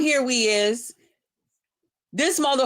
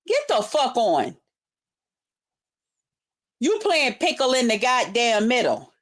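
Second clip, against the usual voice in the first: normal talking voice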